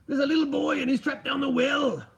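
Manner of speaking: high, angry mock-Scottish accent; hammed-up angry Scotsman, pitched well up; Willie from The Simpsons. Streamer demonstrating angry Scottish inflection for Robbo, hammed-up cartoon Scotsman. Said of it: "sound angry if you're a Scotsman like Willie".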